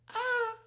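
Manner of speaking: short high-pitched yelp; a quick startled little scream; mock light scream from outside. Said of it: "like, this light scream coming from the backyard".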